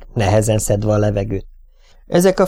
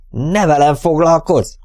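raised, drawn-out exclamation at higher pitch; higher-pitched cry of protest; angry shout; 6 semitones higher than usual. A narrator's reading. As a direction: shout it back, stretching the words. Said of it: "kiáltotta".

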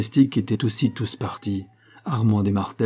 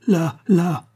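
high, urgent exclamation; understated panic, pitched well up; horrified cry; 8 semitones higher, 4 dB louder than usual. A narrator's reading. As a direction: cry out in alarm at a dreadful discovery. Horrified mother points down well.

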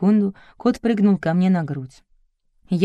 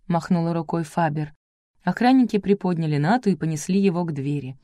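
ordinary delivery

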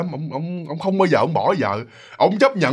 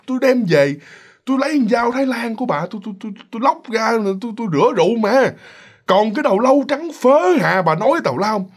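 higher-pitched, insistent husband; indignant denial; 6 semitones higher than usual. Retold or imitated. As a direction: brush her story off as nonsense. Chồng gạt lời vợ. (giọng chồng cãi, gạt phăng)

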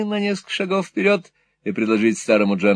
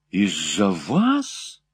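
amazed; 3 semitones lower, much slower than usual. A narrator's reading. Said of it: "с величайшим изумлением воскликнула Кэт".

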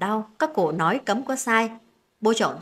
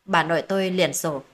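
usual speaking voice

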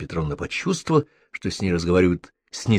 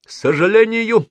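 hesitant; 12 semitones higher, 5 dB louder than usual. A narrator's reading. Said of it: "тут старшина Перепчук замялся".

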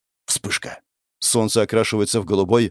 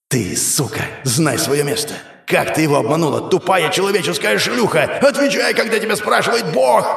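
furious rising tirade; 8 semitones higher than usual. Acted. Goddess berates princess.

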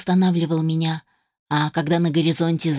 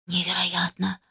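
soft amazed whisper; whispering in wonder; 5 dB quieter than usual. Acted. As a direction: breathe it out, pleasantly stunned. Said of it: "прошептал он с приятным удивлением".

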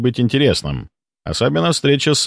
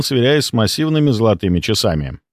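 usual speaking voice

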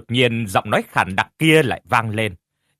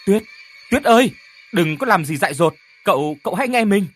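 high, loud, fast pleading; urgent shout; 7 semitones higher, 5 dB louder than usual. Acted. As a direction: shout to stop a friend from harm. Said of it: "rồi la lên".

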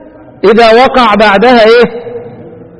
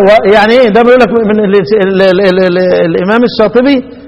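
normal talking voice

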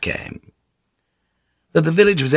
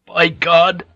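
higher, louder cry of shock; startled oath, pitched up; pained oath; 8 semitones higher, 5 dB louder than usual. A narrator's reading. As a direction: swear out loud as pain hits. Struck man crying out in pain.

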